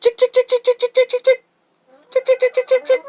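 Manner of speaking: very high rapid chick-chick call; sing-song high call to the flock; calling chickens. Real, not acted. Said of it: "I've been training my girls to recognize that sound".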